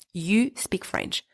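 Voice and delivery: normal talking voice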